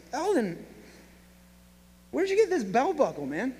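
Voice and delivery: a lightly voiced startled Marvin; surprised neighbour.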